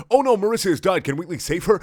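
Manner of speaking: high, loud mock suspense; hammed-up episode teaser; cliffhanger announcer. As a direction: tease the next episode, loudly. Mock cliffhanger teaser after chapter end.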